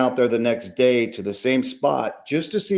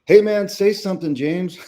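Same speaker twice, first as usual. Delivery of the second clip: urgent nudge; 7 semitones higher, 3 dB louder than usual. Retold or imitated. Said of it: "He's like".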